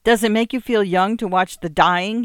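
loud, bitter shouted accusation. A narrator's reading. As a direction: throw it at her with contempt. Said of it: "he shouted".

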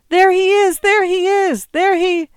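very high, loud shout above a din; shrill it out over the crowd; piercing, frantic call of recognition; shrill cry. A narrator's reading. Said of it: "Emily shrilled above the din".

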